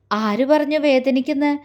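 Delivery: ordinary delivery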